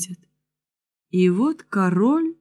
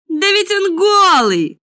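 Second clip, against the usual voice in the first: put-on very high, sudden childlike exclamation; high-pitched astonished child's shout; little boy's cry; 11 semitones higher, 4 dB louder than usual. A narrator's reading. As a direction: blurt it out like a small boy. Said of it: "закричал вдруг какой-то маленький мальчик".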